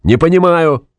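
high-pitched call over noise; shouted reply. A narrator's reading. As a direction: shout back, not having understood. (ответный выкрик)

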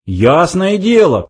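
high, drawn-out mild shout; calling out. A narrator's reading. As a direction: call back, stretching the words. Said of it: "крикнул Форд".

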